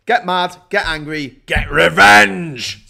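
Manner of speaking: booming mock villain declaration; Duke Vengeance. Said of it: "says Duke Vengeance".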